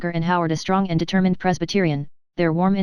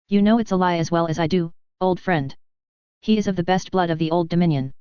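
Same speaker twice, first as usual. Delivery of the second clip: ordinary delivery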